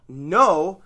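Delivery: "No" hit hard, loud and drawn out; emphasis on No. Shown as a demonstration. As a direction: punch the word "No" firmly before apologising. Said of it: "perhaps with some emphasis on the word".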